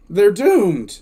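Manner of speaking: emphatic exclamation. Real, not acted. Host reacting to the troopers losing their arsenal, emphatic. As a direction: exclaim it harder, with dismay.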